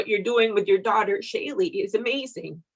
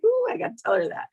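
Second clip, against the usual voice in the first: high, fast, eager "Ooh"; excited thought; much faster than usual. Retold or imitated. Friend recalling a sudden urge to tell Denise, high and rushed. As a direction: blurt it out all at once like a sudden excited idea. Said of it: "I was like".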